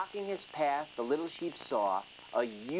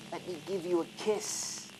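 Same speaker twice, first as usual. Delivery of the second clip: hissing snake; 3 semitones higher than usual. Acted. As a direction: tempt the lamb, lightly hissing. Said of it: "He started to hiss".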